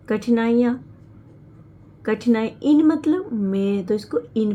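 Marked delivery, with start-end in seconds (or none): none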